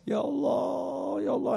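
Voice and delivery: high, drawn-out mock wail; imitated weeping prayer. Pilgrim heard during tawaf, imitated tearful cry to Allah. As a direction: imitate someone crying out softly to God, stretching the words. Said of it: "dengar suara orang lagi nangis".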